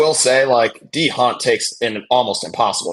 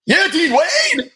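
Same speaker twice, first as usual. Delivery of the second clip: excited cheer; slower than usual. Real, not acted. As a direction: cheer him on at the top of your voice. Cheering a teammate's play.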